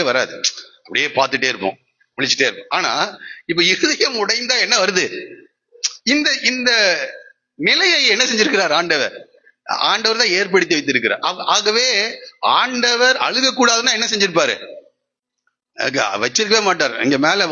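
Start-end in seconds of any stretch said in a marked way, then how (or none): none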